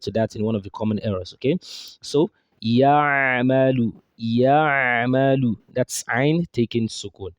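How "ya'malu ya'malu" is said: Ya'malu stretched, Ain pressed hard from mid-throat; exaggerated throat sound. Shown as a demonstration. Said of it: "I'm here exaggerating it".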